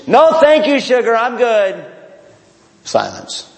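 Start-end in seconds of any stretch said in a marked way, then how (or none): 0.1-1.8 loud, high mock honk; comic honking reply, raised voice; honky voice. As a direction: honk it back, pitched well up. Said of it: "Honky voice".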